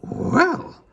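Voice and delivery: a high, loud, outraged Well; angry astonished. A narrator's reading. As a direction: explode in shock. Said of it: "in a most angry and astonished voice".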